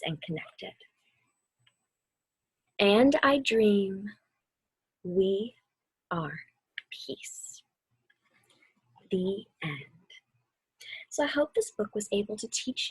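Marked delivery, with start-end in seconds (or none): 2.8-9.8 slow start, sinking to a breathy whisper on peace; fading to a whisper. A narrator's reading. Closing the children's book for listeners, fading whisper. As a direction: let the last words dissolve into a whisper.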